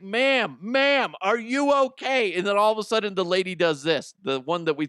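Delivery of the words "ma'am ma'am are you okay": high, louder calls; calling out to her. Retold or imitated. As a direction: call across the water to wake her. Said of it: "I was like".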